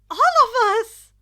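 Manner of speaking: loud, high, slow cheer of all of us; group shout. A narrator's reading. Friends answer together with a loud cheer. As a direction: shout it out like a crowd.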